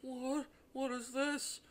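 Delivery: mock high puzzled question; a silly high, mild what; mocking her calm reaction to dead bodies; underwhelmed reaction. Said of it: "instead of screaming, she's just like".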